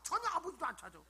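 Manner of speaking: ordinary talk, nothing marked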